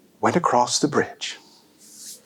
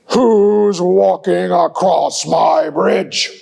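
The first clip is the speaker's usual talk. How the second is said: menacing troll; 2 semitones higher, 4 dB louder, slower than usual. Acted. Troll demanding who crosses his bridge, slow and menacing. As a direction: draw it out like a big troll. Troll challenges big goat.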